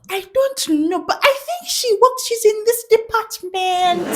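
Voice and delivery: very high put-on voice; tattletale informant. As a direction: mimic a gossip giving up details. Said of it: "And I'm like".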